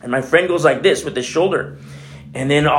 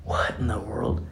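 slow, hushed whisper of disbelief; stunned whisper; 6 semitones lower, 10 dB quieter than usual. Retold or imitated. Sailor stunned after elders prayed over his shoulder, slow whisper. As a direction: breathe it out, amazed.

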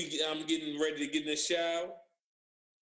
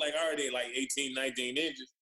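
usual speaking voice

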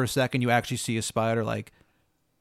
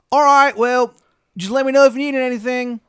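loud, high, exaggerated Maryland twang; terrible Maryland accent; 12 semitones higher, 9 dB louder than usual. Baba Yaga greeting a newcomer, bad Maryland accent. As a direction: holler in a hammed-up Maryland accent. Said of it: "She's got the shittiest Maryland accent".

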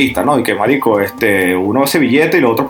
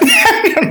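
high laugh. Real, not acted. Laughing at the joke.

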